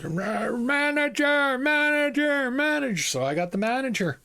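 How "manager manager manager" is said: high, slow, drawn-out demanding bark; angry customer. Retold or imitated. Rude Quebecois customer demands the manager, high-pitched and slow. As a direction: wail for the manager like an entitled brat. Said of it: "was he angry".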